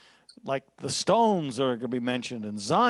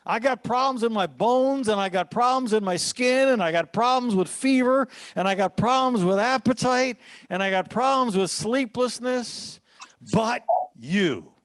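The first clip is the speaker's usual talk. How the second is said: building lament; 6 semitones higher than usual. Acted. Voicing psalmist listing troubles.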